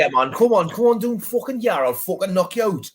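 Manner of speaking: put-on high, rowdy old Geordie accent picking a fight. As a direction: Geordie threatening to knock him out, hammed up. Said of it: "go an old Geordie".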